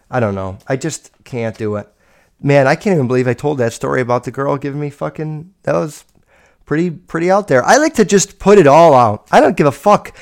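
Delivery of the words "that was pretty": normal talking voice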